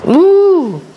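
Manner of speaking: a jolt of pain forced out in a grunt; pained high grunt. Real, not acted. Patient jolted by pain mid-stretch: high pained grunt. Sudden pain during therapy.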